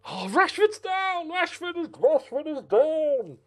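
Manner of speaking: high, loud, slow alarmed wail; dismayed cry. Real, not acted. Gamer wails as his striker goes down injured.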